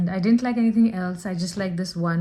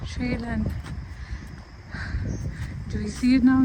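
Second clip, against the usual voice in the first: excited; 6 semitones higher, 4 dB louder than usual. Real, not acted. Spots a rabbit on the trail.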